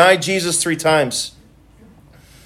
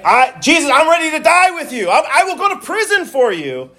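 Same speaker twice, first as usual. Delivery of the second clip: Peter's brash promise, pitched higher; boastful Peter; 9 semitones higher than usual. Retold or imitated. Preacher voicing Peter boasting to Jesus, higher and proud. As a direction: puff up, overconfident vow. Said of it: "he boasted, saying".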